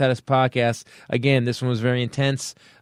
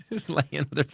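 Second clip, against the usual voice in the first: softer, words after a burst of laughter; laughing; 7 dB quieter than usual. Real, not acted. Comic sorry for laughing at the runner's fall, laughing. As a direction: apologize while still amused. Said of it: "I'm laughing".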